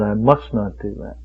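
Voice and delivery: normal talking voice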